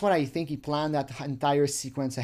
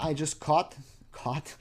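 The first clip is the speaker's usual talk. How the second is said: ordinary talk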